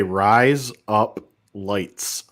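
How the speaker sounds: put-on kid's party-trick Aussie accent; Australian accent demo. Shown as a demonstration. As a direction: say it slowly so it sounds Australian. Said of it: "you say".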